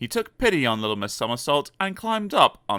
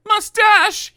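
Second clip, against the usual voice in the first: crying out; 9 dB louder than usual. Acted. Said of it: "he cried".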